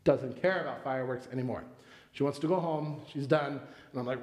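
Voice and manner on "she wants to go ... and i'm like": normal talking voice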